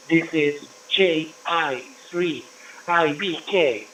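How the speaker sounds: usual speaking voice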